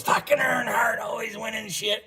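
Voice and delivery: hammed-up grumble of an annoyed father; imitating dad griping. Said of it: "all I heard was".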